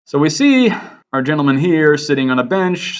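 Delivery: ordinary talk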